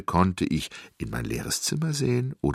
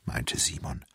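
usual speaking voice

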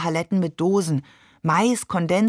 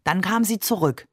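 plain reading voice

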